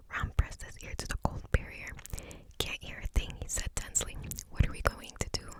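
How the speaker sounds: plain reading voice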